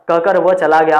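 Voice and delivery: ordinary talk, nothing marked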